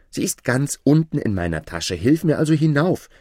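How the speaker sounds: put-on high, loud boyish appeal; young Aladin insisting. Aladin asks the sorcerer to help him up, high urgent appeal. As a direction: urge him insistently, pitch climbing.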